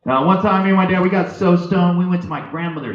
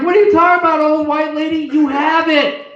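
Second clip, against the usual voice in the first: high, loud incredulous retort; acted outrage at a heckler; indignant comeback; 11 semitones higher, 6 dB louder than usual. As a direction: fire back, pitched way up.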